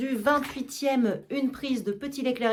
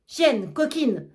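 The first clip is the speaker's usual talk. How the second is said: angry cursing; 3 semitones higher, 3 dB louder, much slower than usual. Acted. Master cursing absent servant.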